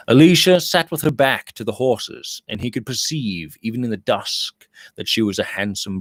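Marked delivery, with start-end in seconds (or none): none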